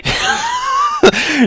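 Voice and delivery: shouted high through laughter; laughing shout. Real, not acted. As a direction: blurt it out laughing.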